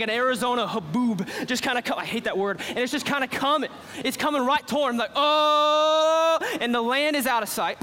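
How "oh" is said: long, loud, held "oh" of dread; a raised, sustained "ohhh" as it looms; drawn-out alarmed "oh". Acted. Reacts to the cloud coming.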